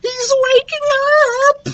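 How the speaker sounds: very high, panicked squeal; wailing, frightened little voice; crying out. A narrator's reading. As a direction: squeak it out in alarm. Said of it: "Lucas cried out".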